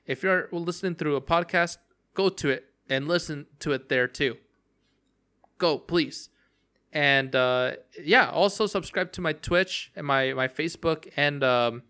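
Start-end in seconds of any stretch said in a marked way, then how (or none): none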